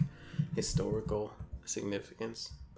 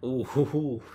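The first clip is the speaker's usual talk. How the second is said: mock scandalized ooh; 4 semitones higher, 7 dB louder, slower than usual. Real, not acted. Reacting to racy monster profile.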